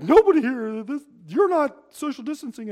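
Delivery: mock panicked yelling at the store crowd; hysterical shopper. A shopper yelling at people in a store, in mock hysterics. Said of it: "like, getting into hysterics".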